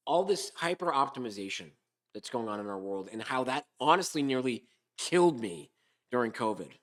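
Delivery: ordinary delivery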